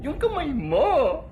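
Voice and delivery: high, slow melodramatic cry; overdramatic. Acted. Friend crying out over pal's hand, overdramatic wail. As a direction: stretch it out in hammed-up distress. Third take, exaggerated drama.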